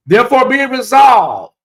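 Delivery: grand, loud and high proclamation; loud mock proclamation. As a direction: declare it like a solemn verdict. Formal resolution catchphrase.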